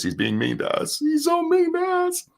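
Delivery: soft complaint climbing into a very high whine; mock whining. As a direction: mimic a put-upon crybaby, rising to a squeaky falsetto. Mocking a complaint of being wronged.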